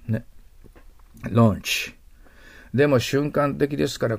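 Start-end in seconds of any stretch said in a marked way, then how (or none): 1.3-2.0 ランチ as "lawnch", long O; firm ランチ with the au drawn out; English "launch". Shown as a demonstration. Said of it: "エーユーもそうなんです".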